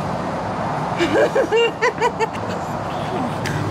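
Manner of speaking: long high giggle; high squealing laugh. Real, not acted. Laughing about Bentley.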